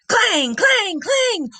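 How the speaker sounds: bang out each clang sharply; clanging lockers. A narrator's reading. Lockers slam in hall.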